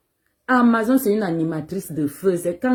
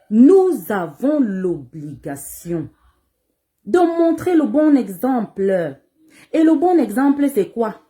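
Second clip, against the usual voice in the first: forceful preaching; 6 semitones higher, 6 dB louder, slower than usual. Real, not acted. Preaching duty to younger artists.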